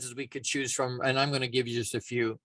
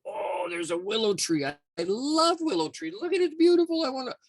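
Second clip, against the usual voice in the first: gushing acorn; 9 semitones higher than usual. Acted. Acorn pops up and spots a willow tree, gushing. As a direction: gush in an exaggerated high voice.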